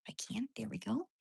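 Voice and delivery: soft whispered coo; whisper to dog. Real, not acted. Woman whispering softly to her dog. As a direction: hush it gently to the pet.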